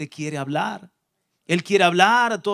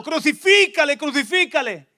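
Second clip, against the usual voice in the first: high, loud crowd cry; the crowd yelling for crucifixion; crowd shouting; 7 semitones higher, 6 dB louder than usual. Acted. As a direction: shout it as an angry mob.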